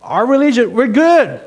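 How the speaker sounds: high, loud, cocky boasting; mock the self-satisfied teachers of the law; smug religious people. Preacher mocking proud religious leaders, smug loud boast. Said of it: "Their attitude was like".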